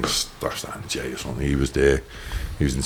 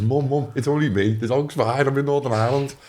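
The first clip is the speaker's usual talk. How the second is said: reassuring his mum in a much higher voice; high pitched call; 9 semitones higher than usual. Retold or imitated. Telling Mum it's only him, voice raised high. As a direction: pitch it way up, eager to calm her.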